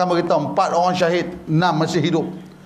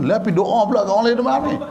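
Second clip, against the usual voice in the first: ordinary talk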